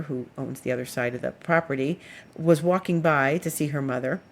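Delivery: ordinary delivery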